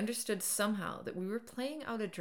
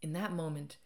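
ordinary delivery

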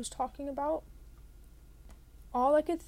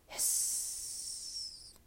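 hiss; 10 dB quieter than usual. Acted. Monster dissolving sound effect.

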